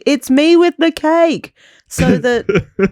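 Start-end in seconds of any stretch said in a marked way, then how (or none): 0.1-1.5 high, drawn-out pretend call. Co-host suggesting what Ross should call to the party, mock calling out. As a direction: announce yourself as if through a door. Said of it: "He can just call from outside the door".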